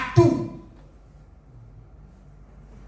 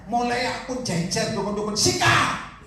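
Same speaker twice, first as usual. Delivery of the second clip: sikat flung out high; fierce challenge; 4 semitones higher, slower than usual. Retold or imitated. Preacher quoting his own challenge to shamans, fierce. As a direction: spit out the last word in defiance. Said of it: "saya sering ngomong".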